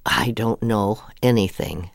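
ordinary delivery

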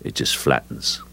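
low, flattened-out delivery. Shown as a demonstration. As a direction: let the voice sink and flatten right out. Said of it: "just flattening the voice out".

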